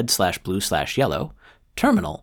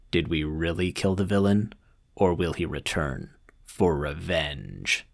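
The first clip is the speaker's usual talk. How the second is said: ominous trailer voice; slower than usual. Acted. Horror trailer style ending.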